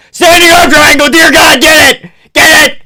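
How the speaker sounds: high, very loud urging; excited yelling. Real, not acted. Fan urging the fighter to get a submission, excited yell. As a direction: scream at the fighter to finish.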